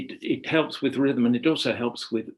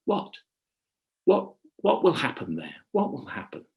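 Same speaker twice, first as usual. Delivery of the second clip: curious listener; 3 semitones higher than usual. Shown as a demonstration. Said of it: "what we're doing as listeners is saying".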